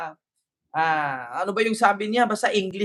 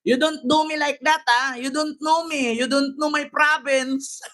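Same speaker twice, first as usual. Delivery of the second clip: high-pitched mock grievance, breaking into laughter; mock protester; 4 semitones higher than usual. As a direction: mimic her aggrieved list of complaints. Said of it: "Pareho kami ni ate mag-English". (kunwaring hinanakit, mataas ang boses)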